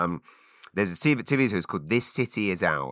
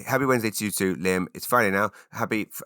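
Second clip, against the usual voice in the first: ordinary talk, nothing marked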